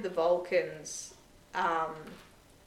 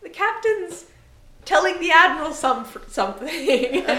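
laughing excuse; 10 semitones higher, 10 dB louder than usual. Retold or imitated. Michael making an evasive excuse.

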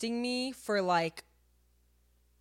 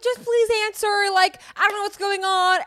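high, louder pleading; retold plea, pitched way up; desperate pleading; 10 semitones higher, 8 dB louder than usual. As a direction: beg him to just answer. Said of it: "And then I get sad again".

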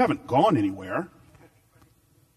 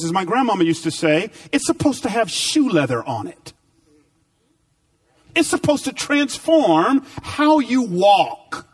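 full-force preaching; 5 semitones higher than usual. Acted. Preacher urging the church that scripture must change how they walk, preaching at full force. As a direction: lift the congregation toward the point.

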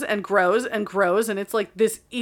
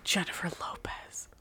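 unvoiced whisper of a name; 2 semitones lower, 10 dB quieter than usual. Real, not acted. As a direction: hush it like a secret. Whispered name, answered What.

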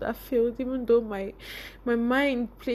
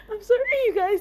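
high, near tears; apologizing as tears come; emotional; 8 semitones higher, 5 dB louder, faster than usual. Real, not acted. Apologizing to viewers, choked up with emotion. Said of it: "This is just so emotional for me".

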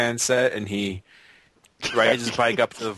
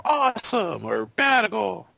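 put-on excited kids; 11 semitones higher than usual. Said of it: "they're all".

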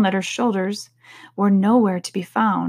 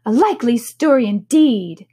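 scoffing, raised-pitch dismissal; scornful pigeon; deep contempt; 4 semitones higher, 5 dB louder than usual. A narrator's reading. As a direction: sneer it louder and higher. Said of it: "in a tone of deep contempt".

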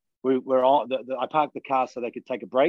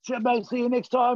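much higher, friendly farewell call; cheery goodbye; 11 semitones higher than usual. Retold or imitated. Runner calling from his car window, higher and cheery. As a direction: sing out a bright see-you. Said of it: "he's like".

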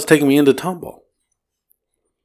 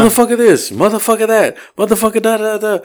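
high-pitched imitation of ranting; mock insults; 10 semitones higher than usual. Driver mimicking the insult video on the phone, high mock ranting. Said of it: "I hear nothing but insults".

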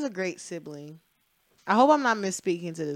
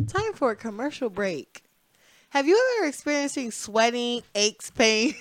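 higher, louder hammed-up announcer; mock ad voice; 5 semitones higher, 6 dB louder than usual. Podcast host jokingly cutting to a commercial, announcer style. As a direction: sell it like a cheesy TV commercial.

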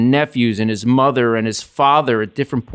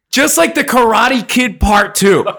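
ordinary talk, nothing marked

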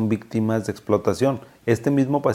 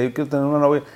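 patient's plea; 6 semitones higher, much faster than usual. Retold or imitated. Patient insists to his doctor he wants a girlfriend, raised plea. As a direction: plead it up high like an eager patient. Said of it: "me daba mucha cosa cuando me decía".

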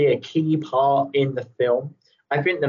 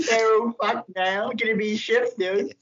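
high, giggly mock-Aussie voice; Australian accent; 8 semitones higher than usual. Joking as a teacher turned chef, laughing Australian accent. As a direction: clown as an Australian contestant. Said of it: "sorry for any Australians".

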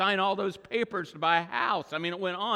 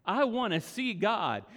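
usual speaking voice

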